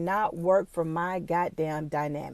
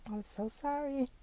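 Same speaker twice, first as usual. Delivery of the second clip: very soft, higher, tearful apology; real choked-up, small voice; overcome with emotion; 5 semitones higher, 11 dB quieter than usual. Real, not acted. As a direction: squeeze out an apology through tears. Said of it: "I'm not gonna get emotional".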